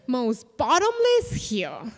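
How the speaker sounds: usual speaking voice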